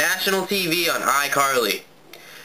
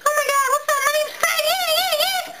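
extremely high squeaky mocking chipmunk voice; Fred's chipmunk voice. Vlogger mocks the YouTuber by copying his extremely high chipmunk voice. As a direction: shoot way up into a squeaky cartoon voice to mock him. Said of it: "he can talk like".

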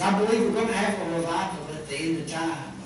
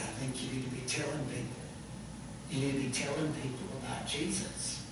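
much softer and lower, sincere advice; soft sincere reply to a troubled man; gentle counsel; 4 semitones lower, 10 dB quieter than usual. Real, not acted. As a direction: lean in and answer him quietly. Preacher's gentle answer to the man.

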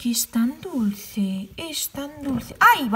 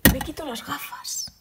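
whispered, softer; soft unvoiced whisper; 9 dB quieter than usual. Real, not acted. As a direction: hush it as if moved. Emotional, taking off glasses.

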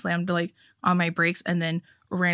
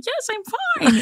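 squeaky forced cheer, much higher; high fake-cheery reply; much slower than usual. Embarrassed cyclist to homeless man, squeaky fake cheer. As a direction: brush it off brightly, voice way up. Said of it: "And I was like".

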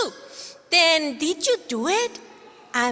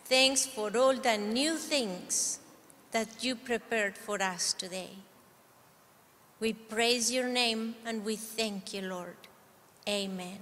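quiet prayer; 5 semitones lower, 11 dB quieter than usual. Real, not acted. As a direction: pray it softly, winding down. Leader prays with kids.

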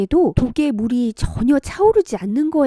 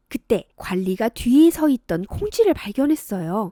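usual speaking voice

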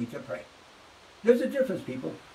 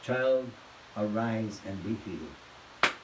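gentle command; 8 semitones lower, 3 dB quieter, slower than usual. Retold or imitated. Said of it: "and says".

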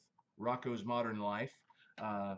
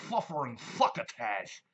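lisping, louder Sylvester exclamation; 6 dB louder than usual. Retold or imitated. Showing viewers Sylvester's catchphrase, lisped. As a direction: spit it out with Sylvester's lisp. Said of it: "you got Sylvester".

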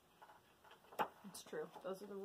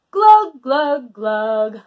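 silly glugging; 10 dB louder, much slower than usual. Acted. Reads silly sounds.

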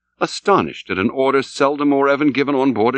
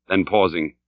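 ordinary delivery

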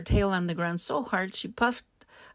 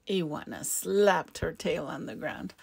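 plain reading voice

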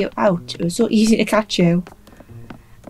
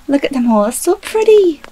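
gushing; 6 semitones higher, 4 dB louder than usual. Real, not acted. Gushing over butterflies.

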